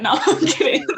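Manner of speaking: laughing through "kidding"; giggling retraction. Real, not acted. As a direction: take it back with a laugh. Joking after teasing line.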